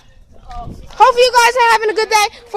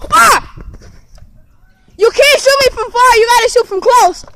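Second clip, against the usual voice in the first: shouting; 5 semitones higher, 7 dB louder than usual. Real, not acted. Shot during nerf war.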